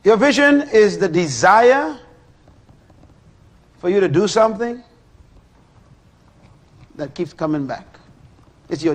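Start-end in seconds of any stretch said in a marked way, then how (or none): 0.0-4.8 proclaiming. Real, not acted. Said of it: "preacher proclaims".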